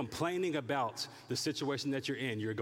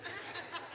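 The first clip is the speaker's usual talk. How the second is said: soft breathy laugh; 8 dB quieter than usual. Real, not acted. Laugh after mock call.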